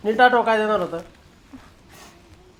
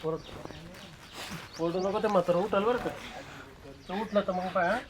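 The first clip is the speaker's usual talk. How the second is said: hushed threat; 16 dB quieter, slower than usual. Acted. Husband quietly threatening his wife not to shout. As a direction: keep it down so grandpa won't wake.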